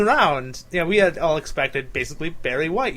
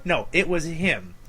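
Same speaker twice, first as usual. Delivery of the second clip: ordinary talk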